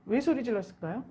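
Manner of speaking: ordinary delivery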